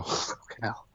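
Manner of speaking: a helpless breathy laugh over the words; breathless laugh. Real, not acted. Can't read for emotion, laughs.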